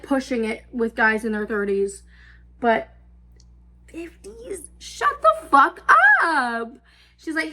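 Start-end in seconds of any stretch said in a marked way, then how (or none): 3.9-6.7 much higher, drawn-out shut the fuck; can't believe it, exploding; outraged disbelief. Real, not acted. About a man in his fifties, outraged and high.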